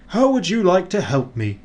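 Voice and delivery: high cheery question; Father Christmas voice. Acted. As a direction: ask kindly, lifted up. Father Christmas asks snowman.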